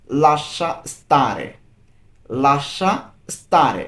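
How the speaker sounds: slow and clear; slow model phrase. Shown as a demonstration. Teacher models the phrase for a learner, slowly. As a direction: spell it out slowly. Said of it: "এর Italian".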